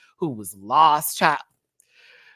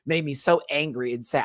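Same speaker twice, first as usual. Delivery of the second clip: normal talking voice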